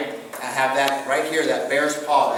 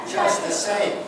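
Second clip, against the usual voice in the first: "Just the same" sung out high, with "Just" on top and falling away; high falling take; slower than usual. Shown as a demonstration. Repeating the phrase for the class, pitched high and falling. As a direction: lift "Just" high and let the line fall.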